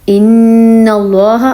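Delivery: ان اللہ drawn out, nun held long through the nose; slow nasal ghunna. Shown as a demonstration. Teacher slowly demonstrating ghunna for students to copy. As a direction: stretch the ن in ان اللہ, tongue on the palate. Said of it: "آواز جو ناک پہ گئی ہے".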